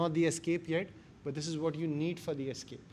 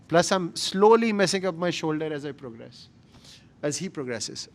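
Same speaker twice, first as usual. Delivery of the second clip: pushed out loud under strain; straining with effort; 8 dB louder than usual. Real, not acted. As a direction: talk while pushing hard against a partner. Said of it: "the kind of efforts it requires, you can match it with my voice".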